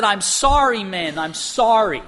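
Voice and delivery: pleading mock-tearful apology; retold tearful apology. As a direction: act out a crying man apologizing, without real tears. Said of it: "uncontrollably crying".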